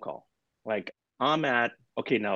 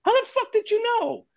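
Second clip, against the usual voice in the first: stunned disbelief; 4 dB louder than usual. Retold or imitated. Stunned reply to sister's guess.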